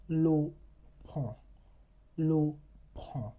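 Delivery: pon with nasal on; French nasal example. Shown as a demonstration. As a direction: model pon slowly, nasal. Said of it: "You have letters".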